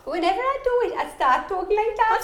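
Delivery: high, put-on northern accent; Teesside accent. Shown as a demonstration. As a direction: slip into a Sarah Millican-style Teesside voice. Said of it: "That's Welsh".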